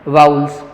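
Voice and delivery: वाउल्स as "vauls", open au, no b; correct pronunciation. Shown as a demonstration. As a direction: repeat वाउल्स as a firm model for pupils. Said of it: "वापस पढ़ें".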